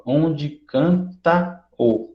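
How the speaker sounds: "Onde canta o" drawn out slowly, "canta" running into "o"; joined syllables. Shown as a demonstration. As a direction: stretch "Onde canta o" and glide "canta o" together as one syllable. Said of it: "tá vendo que tá juntinho?". ("Onde canta o" bem lento, unindo "canta" ao "o")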